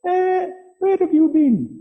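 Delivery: very high, slow mock-royal English accent; drawn-out falsetto take on a royal English accent; Queen Elizabeth II. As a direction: put on a loud, high-pitched regal English accent. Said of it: "That's my Queen Elizabeth the second act".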